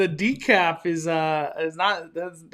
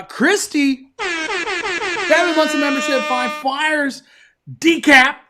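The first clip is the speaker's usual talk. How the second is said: high, loud host cheer; hyped shout-out; 8 semitones higher, 4 dB louder than usual. Real, not acted. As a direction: punch it out like a live-stream hype man. Hyping a member shout-out on stream.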